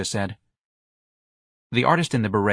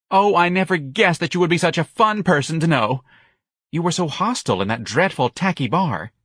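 high, theatrical delight; gushing young man; 9 semitones higher, 4 dB louder than usual. Acted. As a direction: gush to a new acquaintance.

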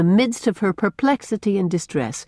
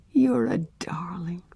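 soft, slow, tender whisper; a hushed loving reassurance; 4 dB quieter than usual. A narrator's reading. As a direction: cherish the child. Rosemary tender to child.

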